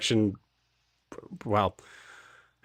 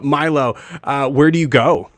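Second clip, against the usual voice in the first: ordinary talk, nothing marked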